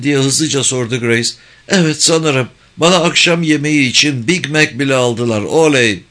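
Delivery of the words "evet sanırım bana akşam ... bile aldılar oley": put-on higher, eager child voice; a kid proud of his Big Mac; Lane as a boy. A narrator's reading. Lane tells his mother he is fine, higher and eager. Young son on the phone.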